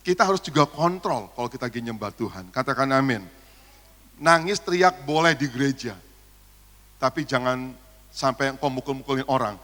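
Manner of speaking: ordinary delivery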